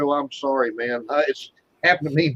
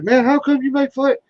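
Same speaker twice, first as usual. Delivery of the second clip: high, lightly voiced upset kid; imitating a crying kid; 9 semitones higher, 3 dB louder than usual. Retelling how the upset kid cried at him, mild crying imitation. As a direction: mimic the hurt boy asking why, a hint of tears. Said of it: "He started crying".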